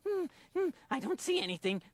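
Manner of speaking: ordinary talk, nothing marked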